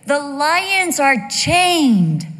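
very slow, loud proclamation; slow booming announcing voice. Retold or imitated. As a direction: call out from the castle. Voice from the castle.

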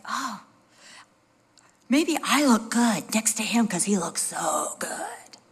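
breathy whispered inner thought; mock confiding whisper. Wife confessing why she liked her handsome husband, playful whisper. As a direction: whisper it like a secret admission.

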